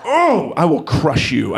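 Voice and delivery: slow mock villain threat; menacing dad. Father threatening his six-year-old, slow mock menace. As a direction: drag out the threat like a movie bad guy. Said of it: "I told him that".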